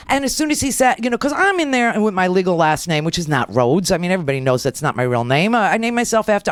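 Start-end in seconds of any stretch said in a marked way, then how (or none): none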